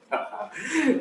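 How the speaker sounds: high burst of laughter; short laugh. Real, not acted. Lecturer amused by his shouting HOD example, real laugh.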